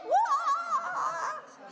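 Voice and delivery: mock startled yelp, high and loud; startled yell. The startled man wakes beside a woman, mock yelp. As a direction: Boaz jolting awake with a yelp. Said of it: "He's startled".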